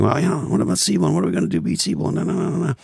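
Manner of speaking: fast, high-pitched mock of the boss; imitated CEO rambling. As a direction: race through the boss's chatter, trailing into nonsense syllables. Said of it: "as John, the CEO is saying".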